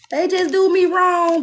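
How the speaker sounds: high, loud mock whine; hammed-up high loud whine; mock whiny complaint. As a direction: whine it like a wronged victim. Mocking a complaining person.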